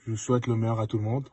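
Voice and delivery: ordinary talk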